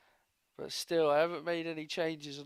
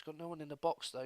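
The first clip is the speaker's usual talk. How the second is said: normal talking voice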